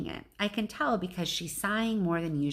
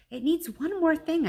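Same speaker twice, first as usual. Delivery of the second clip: Ivan insisting; 10 semitones higher, 4 dB louder than usual. Acted. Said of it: "I say".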